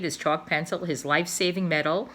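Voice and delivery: ordinary delivery, nothing marked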